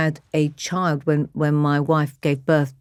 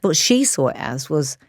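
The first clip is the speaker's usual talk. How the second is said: ordinary talk, nothing marked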